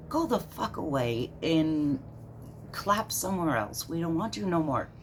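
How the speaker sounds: fed up, shooing someone away; real angry outburst, pitch way up; worked up. Real, not acted. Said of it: "Sorry, I'm getting all worked up".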